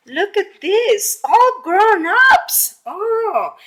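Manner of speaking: gasp, then slow and high with wonder; amazed gasp. Acted. Teacher gasping over a page of grown ladybugs, amazed. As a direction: show them something marvellous.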